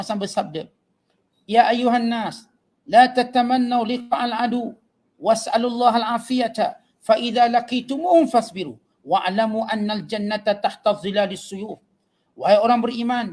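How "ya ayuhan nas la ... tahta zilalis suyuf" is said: slow, measured chant of the Arabic text; Arabic hadith recitation. Acted. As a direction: intone the hadith like a preacher. (bacaan hadis berirama perlahan)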